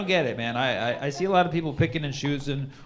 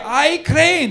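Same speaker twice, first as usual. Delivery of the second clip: high mock bird voice; high hammed-up bird; 12 semitones higher, 7 dB louder than usual. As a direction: answer as a proud little bird. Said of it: "Bird say".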